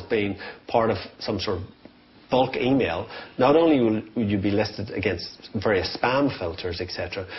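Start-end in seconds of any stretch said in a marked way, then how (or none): none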